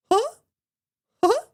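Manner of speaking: high cartoon mouse chuckle; little high-pitched mouse laugh; Mickey Mouse laugh. Shown as a demonstration. Streamer demonstrating the cartoon laugh, high mouse chuckle. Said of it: "you get the laugh".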